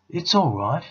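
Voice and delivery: high, loud call from outside; excited beaver shouting all clear. A narrator's reading. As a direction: call out to the cave, relieved. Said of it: "he was shouting".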